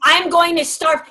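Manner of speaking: hammed-up hunger strike; dramatic dog. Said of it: "some dogs who in that first moment go".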